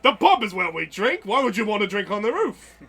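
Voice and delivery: hammed-up British bewilderment; baffled British barkeep. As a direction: protest like a puzzled British pub host. Barkeep resists roof request.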